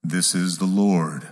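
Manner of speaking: low, slow, booming voice of God. Acted. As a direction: speak down from heaven, calm and grand. God answers the man on the cliff.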